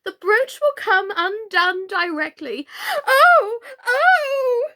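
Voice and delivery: high, loud crying oh; wailing queen. Acted. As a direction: wail in mock distress.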